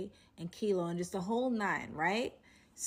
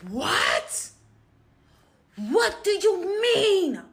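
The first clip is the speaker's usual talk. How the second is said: slow, loud mock yell of disbelief; imitated phone scream; 11 semitones higher, 11 dB louder, much slower than usual. Boyfriend yelling into the phone at homeboy, loud mock shout. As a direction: act out his shout down the phone. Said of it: "starts screaming on the phone".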